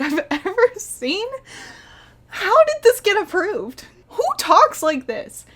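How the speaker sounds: high, loud disbelief; incredulous outburst. Real, not acted. Reviewer appalled at abysmal dialogue, loud incredulous outburst. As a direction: demand an answer, appalled and half laughing.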